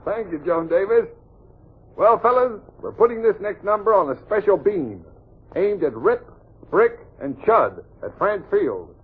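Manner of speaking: ordinary talk, nothing marked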